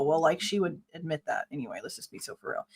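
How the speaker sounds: low, dropping under the breath; sceptical aside. Real, not acted. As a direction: mutter it as a doubting aside. Sceptical aside on what was read.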